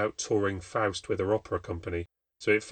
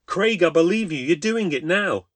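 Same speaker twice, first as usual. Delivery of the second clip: alarmed; 9 semitones higher, 8 dB louder than usual. Acted. Narrator urgently reassures Craig.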